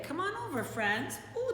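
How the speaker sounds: plain reading voice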